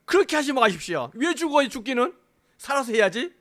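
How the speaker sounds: high, loud acted protest; scolding Peter. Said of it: "야단치면서". (야단치듯 높고 크게 항변하는 말투)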